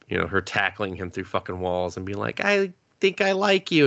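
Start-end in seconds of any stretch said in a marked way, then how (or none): 2.4-3.8 high put-on girlish confession; a lightly played shy girl saying it; mock girl voice. Retelling the heroine's confession in a high mock girl voice. Girl's line in anime retold.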